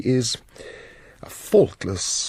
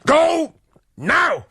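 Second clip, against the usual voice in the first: sharp, loud, higher order; angry command; 4 dB louder than usual. Acted. Lord dismissing his wife, loud angry command. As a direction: bark the dismissal at her.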